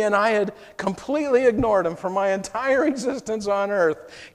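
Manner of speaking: usual speaking voice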